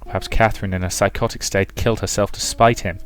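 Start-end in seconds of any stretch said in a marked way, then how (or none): none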